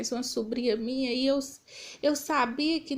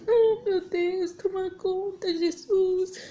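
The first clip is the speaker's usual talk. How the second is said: slow, high, sobbing plea to God; crying prayer; 6 semitones higher, slower than usual. Real, not acted. Woman reliving her vision, crying out to Jesus, sobbing. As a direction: cry out to God through tears.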